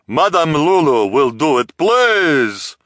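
loud, high, theatrical proclamation; booming announcement. A narrator's reading. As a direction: boom it out to the whole crowd. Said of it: "announced in a booming voice".

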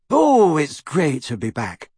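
slow, happy character voice; happy and unhurried; happy Luna. A narrator's reading. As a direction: say it with drawn-out pleasure. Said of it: "said Luna happily".

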